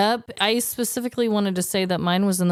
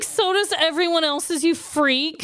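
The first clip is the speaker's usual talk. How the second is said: pitched up, teasing scorn; mock scornful retort; 8 semitones higher than usual. Host mocking the coworker, with high teasing scorn. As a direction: sneer it back in a silly high voice.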